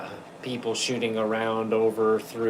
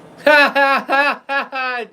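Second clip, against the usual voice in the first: high burst of laughter; 8 dB louder than usual. Real, not acted. Laughing at viewer's joke.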